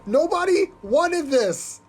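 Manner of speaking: high, drawn-out exasperated outburst. Real, not acted. Host fed up with an unwanted remix, exasperated. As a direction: stretch each word in high-pitched disbelief.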